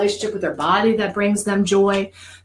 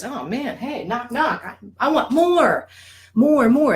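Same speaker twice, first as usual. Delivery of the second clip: put-on craving toddler brain; 5 semitones higher, slower than usual. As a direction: knock and plead like a child wanting more. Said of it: "So the brain goes".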